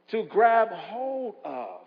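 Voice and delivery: very slow, each word weighted; preacher slowing down to let it sink in; slow repeat. Acted. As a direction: stretch out grab hold of.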